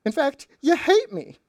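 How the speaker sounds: high, loud mock-hurt whine; mock sobbing guilt trip in a high voice; hammed-up wounded lover. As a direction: overact the betrayed girlfriend. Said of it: "puts on another Oscar-winning performance".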